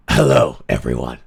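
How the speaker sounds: very deep, low greeting; throat-cleared deep male hello. Shown as a demonstration. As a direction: drop right down into a deep register. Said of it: "I need my male body".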